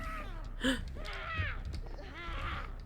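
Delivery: high frightened gasp; sharp gasp. Real, not acted. Sudden scare in game.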